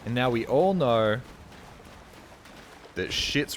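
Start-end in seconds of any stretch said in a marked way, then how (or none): none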